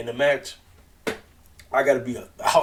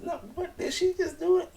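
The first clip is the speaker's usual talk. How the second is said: much higher, laughing disbelief; stunned squeak. Retold or imitated. Imitating Britt left speechless by her friend. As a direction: squeak out the shock through a laugh. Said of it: "Left her speechless, like".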